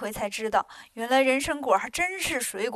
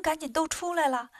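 ordinary delivery, nothing marked

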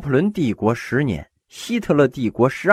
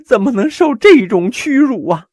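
mock whining complaint; 10 semitones higher, 5 dB louder than usual. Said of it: "哭诉".

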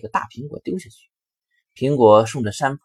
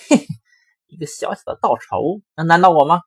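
higher and louder sneering wolf voice; sly big wolf; 7 semitones higher, 7 dB louder than usual. Acted. Big bad wolf sneering at the straw house, gloating. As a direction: gloat with a cocky heh-heh. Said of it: "大灰狼：".

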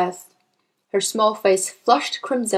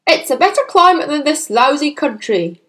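gruff father voice; 5 semitones higher, 5 dB louder than usual. A narrator's reading. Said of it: "the father said".

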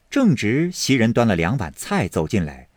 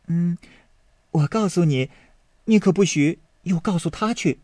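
lightly higher, teasing and coy; a hint of a girl sharing a secret; coy maid; 8 semitones higher than usual. Maid Yinger teasing Baoyu with a secret, lightly coy. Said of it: "婴儿笑道".

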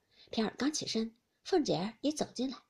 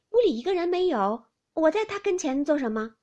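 raised, loud protest; indignant retort; 7 semitones higher, 9 dB louder than usual. Acted. Maid answering her suspicious mistress outside the window, indignant retort. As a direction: protest innocence with force.

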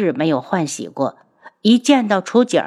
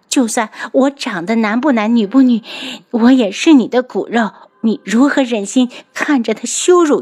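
raised voice; 5 semitones higher than usual. Acted. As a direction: snap it out, voice pitched up. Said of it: "楚景儿拔高了声调". (拔高声调，斩钉截铁)

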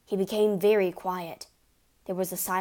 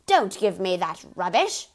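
louder, rough dismissive shout; 8 dB louder than usual. Acted. Father shouts Matilda down, dismissive and loud. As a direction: shout her down. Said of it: "the father shouted".

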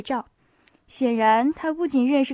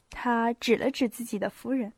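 plain reading voice

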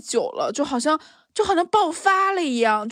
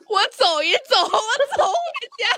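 laughing plea; 9 semitones higher, slower than usual. Retold or imitated. Retells pleading, laughing.

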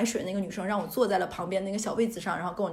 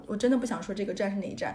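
ordinary delivery

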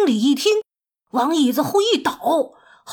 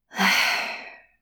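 long, soft, breath-filled sigh; deep sigh; 6 dB quieter than usual. A narrator's reading. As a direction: let out a resigned sigh. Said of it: "他深深地叹了一口气". (深深地叹一口气)

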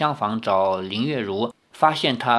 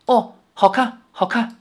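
stunned, higher voice, words stumbling out; dazed reply; 9 semitones higher than usual. A narrator's reading. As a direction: caught off guard, too stunned to think. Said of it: "因此有些愣愣地说". (愣愣地，惊呆了)

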